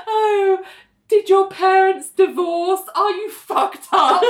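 high, slow, patronising sympathy; mock pity. Mocking John questioning Nell, mock pity. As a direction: coo mock concern at someone.

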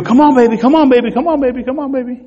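put-on will the baby to breathe, high and fading; coaxing nurse. Nurses tapping the baby's heel, high coaxing.

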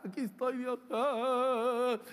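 put-on miserable plea ending in a moan; mock misery. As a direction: act pitiful, trailing into a wail. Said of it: "Tratando de dar miseria".

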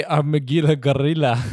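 tentative, half-hearted Scottish accent; attempted Scottish accent. Shown as a demonstration. Trying Scottish on the gorilla's name, attempted Scottish accent. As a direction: have a weak go at Scottish. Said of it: "I could do a Scottish accent".

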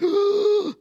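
high, loud mock gasp of discovery; sharp gasp. Bible student discovering a link, loud surprised gasp. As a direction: gasp like you just found something. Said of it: "and you're like".

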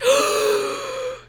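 high dramatic gasp; mock gasp. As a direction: gasp in mock shock at the reveal.

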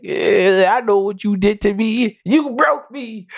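a hint of a hurt son, rising high, slowing at the end; wounded son. Friend voicing the son accusing his father, lightly hurt. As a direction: drag out the accusation.

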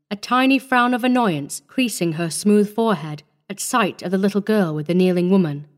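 ordinary delivery

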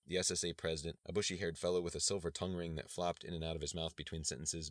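ordinary delivery

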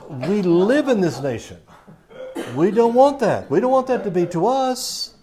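loud, high preaching. Real, not acted. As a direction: drive it home to the congregation. Preacher rises to full force.

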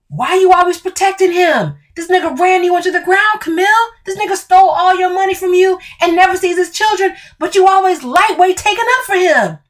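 high, fast and pressing; heated accusation. Acted. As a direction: jab each accusation at her.

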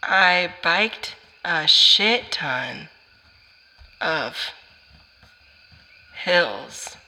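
drawn-out halting reading; slow reading. Real, not acted. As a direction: read each word slowly as written. Slowly reading handwriting.

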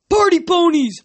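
high, loud team chant; yelled war cry. A narrator's reading. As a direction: whoop it like a rowdy centaur. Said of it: "a centaur yelled".

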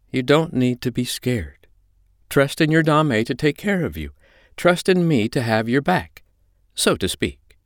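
high-pitched, firm comforting voice; steady them with firm reassurance; reassuring mistress. Acted. China calms a panicking Holly, firm and reassuring.

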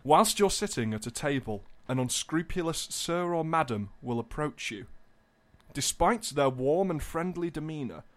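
hushed, deliberate storyteller setting a scene; grave, theatrical reading; mock-dramatic narration. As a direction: read it like a solemn cautionary tale. Reads scam description dramatically.

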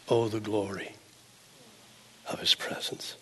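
fading down to a whisper; hushed awe. Real, not acted. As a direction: let the words drop into a reverent whisper. Awed hush about God's presence.

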